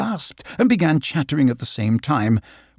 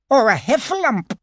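Piglet voice; 11 semitones higher than usual. Acted.

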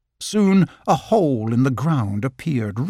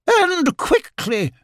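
much higher, haughty and slow on the end; prissy otter; much slower than usual. Acted. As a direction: turn your nose up at the water. Lottie voice.

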